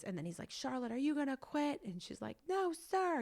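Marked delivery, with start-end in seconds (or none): 0.5-1.8 high mock coach challenge; berating karate teacher. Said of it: "kind of berating her at the same time". 2.5-3.2 high, louder tearful protest; imitated crying child. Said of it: "she's crying and it's just so tough".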